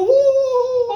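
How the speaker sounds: high whooping cheer; mock cheer. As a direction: whoop like a crowd. Cheer imitated.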